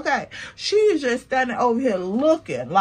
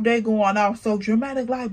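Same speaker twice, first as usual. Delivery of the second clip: normal talking voice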